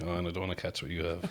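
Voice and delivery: quick, low, soft aside; retold grumble under the breath; muttered reluctance. Man hugging someone like a zombie, muttered reluctance.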